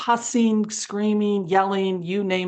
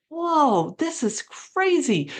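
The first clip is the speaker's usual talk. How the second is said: a higher, stunned whoa; retold amazement; 8 semitones higher, slower than usual. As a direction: relive the disbelief at the chaos. Retold amazement at the thrown cone.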